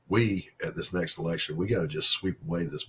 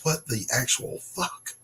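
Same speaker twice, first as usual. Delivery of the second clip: incredulous outrage; 7 semitones higher, slower than usual. Real, not acted. Outraged at girl's arrest.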